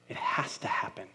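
breathy whisper to yourself; whispered certainty. Pretend. Worker whispering to self leaving boss's office.

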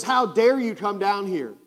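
mild mock fury; retold angry yell. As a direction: throw the accusation at him. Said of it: "he starts screaming these awful things".

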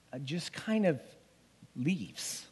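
ordinary delivery

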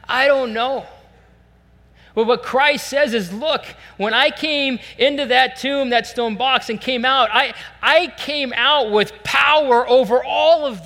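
loud, high, building sermon climax; preaching at full force. Acted. Pastor to congregation, preaching at full force. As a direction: preach it out with rising power.

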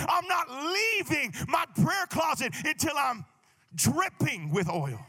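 slow, forceful exhortation with punched key words; full-force preaching. Acted. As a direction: drive it home to the congregation.

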